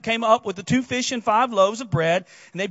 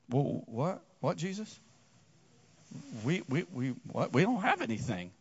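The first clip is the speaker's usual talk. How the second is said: confused disciples; 7 semitones lower, 11 dB quieter than usual. Retold or imitated. Disciples confused at Jesus' command.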